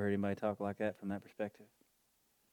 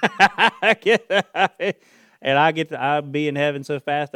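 loud laughing, higher voice; laughing loudly; 7 semitones higher, 18 dB louder than usual. Real, not acted. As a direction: laugh it out hard. Laughing about dying.

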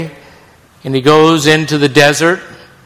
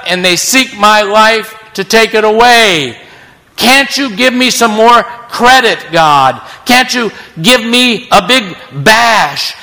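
whining Elijah; 6 semitones higher than usual. Acted. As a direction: beg God for credit, aggrieved. Elijah's complaint voiced again.

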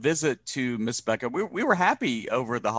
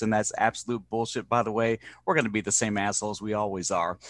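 ordinary talk

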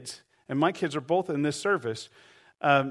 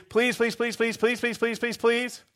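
higher, whiny pleading; hammed-up nagging plea; begging; 6 semitones higher than usual. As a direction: wheedle over and over like a pest at the door. Persistent friend begging at door.

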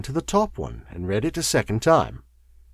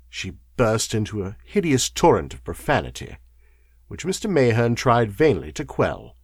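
ordinary delivery

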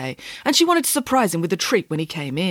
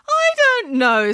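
higher, slow dismissive nanny; Nanny Piggins voice; 4 dB louder, slower than usual. A narrator's reading. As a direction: brush it off grandly. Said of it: "said Nanny Piggins".

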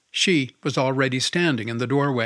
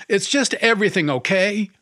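sobbing; 6 semitones higher, 3 dB louder than usual. A narrator's reading. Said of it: "he said, sobbing".

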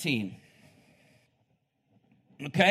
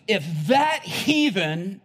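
excited pastor; 4 semitones higher, 6 dB louder, much slower than usual. Retold or imitated. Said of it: "he jumps out of his seat and he goes".

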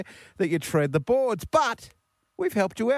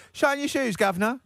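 high Cockney street hawker; street-seller English accent; 10 semitones higher than usual. Acted. Host mimics dodgy watch seller for caller, high Cockney accent. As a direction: pipe up like a back-street London hustler, Cockney accent. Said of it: "that sort of thing".

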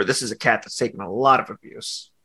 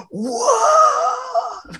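gasping whoa. Pretend. Mock gasp at movie.

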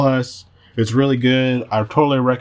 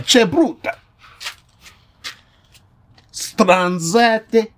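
higher-pitched put-on Italian accent; 4 semitones higher, slower than usual. Trying out slang on some guy, Italian accent. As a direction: play up a mock Italian slang talker. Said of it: "pretty good Italian accent".

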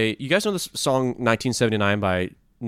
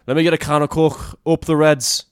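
louder, higher broad Scouse accent; Liverpool accent; 5 semitones higher, 7 dB louder than usual. Shown as a demonstration. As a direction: cheer it out like a Liverpool fan. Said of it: "I'll do Liverpool now".

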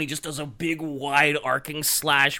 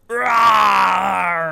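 loud roaring yell, much higher; fierce battle roar; 4 semitones higher, 9 dB louder than usual. Retold or imitated. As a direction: roar it out, full force. Acting the leap into the warp.

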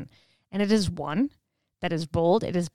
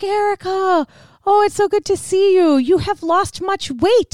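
put-on older Russian woman; 10 semitones higher, 5 dB louder than usual. Russian fitness instructor greets Erica at work, gushing. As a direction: gush hello, then state the weight loss plainly. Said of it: "older Russian woman".

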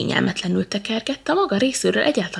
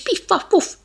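sound effect; 12 semitones higher, 4 dB louder than usual. A narrator's reading.

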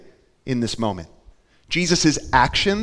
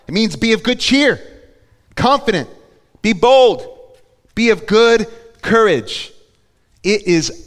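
fervent preaching; 6 semitones higher, 5 dB louder than usual. Real, not acted. Preaching at full force.

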